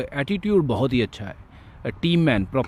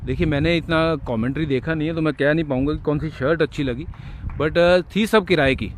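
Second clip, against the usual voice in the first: usual speaking voice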